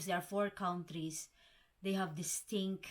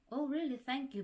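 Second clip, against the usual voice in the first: higher-pitched acted thanks; mock pleased reply; 7 semitones higher than usual. British person answering a compliment on their accent, mock pleased, high-pitched.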